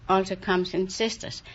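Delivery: usual speaking voice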